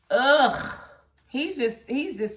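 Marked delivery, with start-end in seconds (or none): 0.1-0.8 loud, disgusted groan; disgusted ugh. Real, not acted. Fan groans in disgust at a character.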